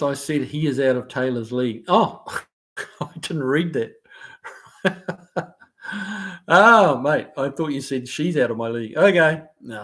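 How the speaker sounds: ordinary delivery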